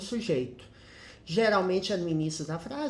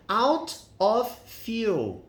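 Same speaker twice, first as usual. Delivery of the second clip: "Out of fuel" stretched out very slowly and said louder; slow teaching demo; 4 semitones higher, 5 dB louder, much slower than usual. Shown as a demonstration. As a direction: spell it out slowly and clearly for the class. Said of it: "sem gasolina". ("Out of fuel" bem devagar e mais alto)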